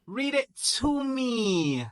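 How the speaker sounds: slow, high, irritated order. Acted. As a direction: drag it out with irritation.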